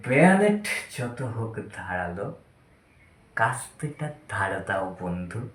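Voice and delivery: verse murmured low and soft to himself; subdued recital. Acted. Drunk playwright recalling a battle verse to himself, subdued. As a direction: recite it quietly, voice sinking.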